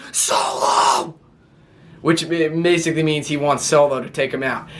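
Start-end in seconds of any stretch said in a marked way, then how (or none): 0.1-1.2 strained, voiceless mock yell; imitated yell. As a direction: yell it without full voice. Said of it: "He yells".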